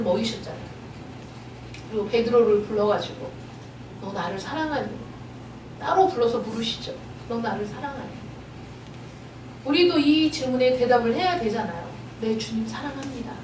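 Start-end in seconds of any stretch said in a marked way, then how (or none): 4.0-4.9 soft, low, intimate asking; tender question. Acted. Preacher as Jesus asking Peter privately, soft and tender. 7.3-8.2 soft, low, intimate asking; tender question. Acted. Preacher as Jesus asking Peter privately, soft and tender. 12.2-13.3 soft, low reply; humble answer. Acted. Believer answering the Lord's question, soft and humble.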